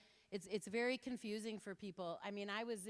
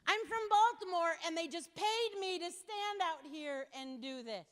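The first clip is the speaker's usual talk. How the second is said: high, loud flustered imitation; flustered young man; 10 semitones higher, 8 dB louder than usual. Speaker imitating the flustered petitioner, high and loud. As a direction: mimic him flustered and pitched up.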